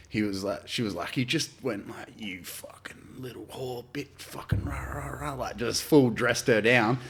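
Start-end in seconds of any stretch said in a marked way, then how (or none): 2.2-5.3 whispered, slow, vicious insult; whispered menace as Ron Jeremy berates the girl; hissed insult. Retold or imitated. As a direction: breathe the slur out in a menacing whisper.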